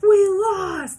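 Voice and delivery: high, loud, ironic cheer; mock triumphant shout. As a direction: shout it as if losing were a victory. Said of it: "No one's like".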